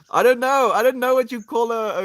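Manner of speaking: loud, high burst of not knowing; flustered outburst. Real, not acted. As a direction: throw it out loudly, voice shooting up. Stumped for the word for horses.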